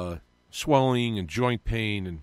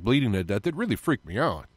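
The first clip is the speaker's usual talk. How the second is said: usual speaking voice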